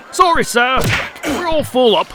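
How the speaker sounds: a mock-genteel ferryman brushing people off, loud and high; prim ferryman. Charon turns away passengers.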